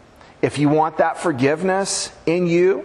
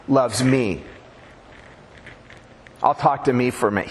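ordinary talk

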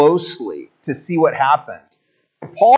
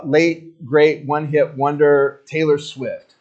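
usual speaking voice

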